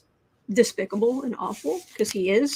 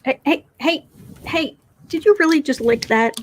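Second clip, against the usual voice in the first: higher, louder hey hey to stop the cat; startled scolding; 4 semitones higher, 5 dB louder than usual. Real, not acted. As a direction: call out sharply to stop the cat licking. Scolding cat licking something.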